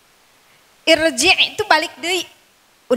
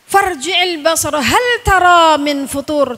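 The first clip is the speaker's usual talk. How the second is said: slow, louder chanted recitation; Quran recitation; 2 semitones higher, 5 dB louder, slower than usual. Acted. Preacher recites the verse to the mothers, slow and chanted. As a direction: intone the verse with care.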